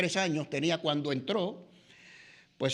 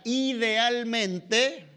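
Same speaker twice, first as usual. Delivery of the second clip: "idealmente" drawn out very slowly and pitched higher; emphasized word; 5 semitones higher, much slower than usual. Shown as a demonstration. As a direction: stretch "idealmente" out, every syllable weighted. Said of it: "y enfatizo esta palabra". ("idealmente" muy lento, enfatizado y más agudo)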